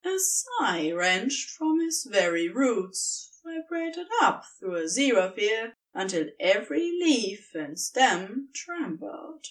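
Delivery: ordinary delivery, nothing marked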